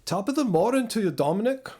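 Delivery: high, lilting mock Irish greeting; Scottish-Irish accent. Shown as a demonstration. Streamer greeting a viewer by name, mock Scottish-Irish accent. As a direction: greet them in a put-on Irish brogue. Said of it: "nebulously Scottish slash Irish accent".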